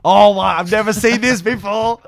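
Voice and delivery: mock-shocked reaction; shocked gasp. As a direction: gasp it out in disbelief. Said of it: "I'm like".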